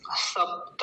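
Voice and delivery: सप्त slowly, half प and whole ta; full त. Shown as a demonstration. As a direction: hold the p closure in सप्त, release a full ta. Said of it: "क्योंकि त पूरा है". (सप्त में प आधा, त पूरा)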